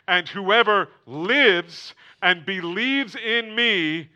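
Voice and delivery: loud, high, slow proclamation; fervent preaching. Acted. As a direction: thunder the promise to the church. Preaching at full force.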